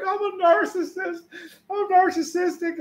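very high-pitched wail, hammed-up; mock bawling. Man retelling his panicked call to his best friend, mock bawling. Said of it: "I was bawling my eyes out".

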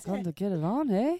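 a hint of Scottish accent; lightly voiced Scottish brogue. Shown as a demonstration. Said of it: "Turn Scottish or".

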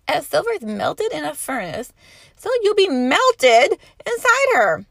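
melted stressed loud and high; fiery emphasis on melted. Acted. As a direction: lean hard on melted. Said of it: "the emphasis on melted".

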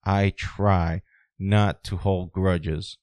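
slow, leaning hard on "try"; stress on try. Shown as a demonstration. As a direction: drag it out and hit "try" in "I try not to hold grudges". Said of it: "I make emphasis on this word".

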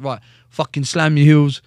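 loud drill-sergeant command; barked order. Retold or imitated. Provost sergeant ordering him into the office, loud barked command. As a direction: bark it like a parade-ground order.

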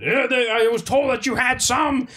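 high, yelled complaint as the meathead; mock angry yell. Angry customer yelling at store staff, mock shout. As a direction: rant it like an outraged customer.

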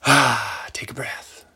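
whispered, savouring exhale; hushed breathing-in. Acted. Ben breathing in the moment before the next tour, whispered.

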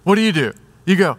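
ordinary talk, nothing marked

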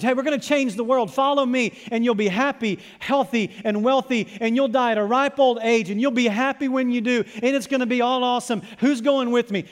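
loud, high-pitched crowd hype; mock motivational recruiter, pumped up; rallying pitch. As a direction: sell a can't-lose promise to recruits. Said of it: "Here's my message".

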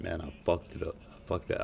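ordinary delivery

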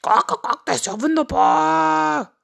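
exclaiming high and loud; delighted rooster cry. A narrator's reading. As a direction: crow with delight as a cartoon rooster. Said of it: "rief Henry".

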